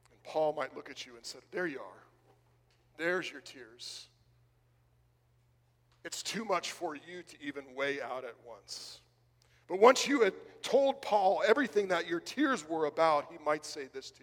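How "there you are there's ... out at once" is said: tender reassurance. Acted. Apostle comforting a friend after their outpouring, tender and hushed. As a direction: settle down and gently acknowledge their pain.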